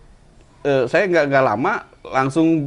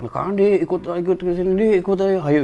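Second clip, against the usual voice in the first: friends calling him along; 7 semitones higher than usual. Retold or imitated. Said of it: "Diajak, ajak teman-teman".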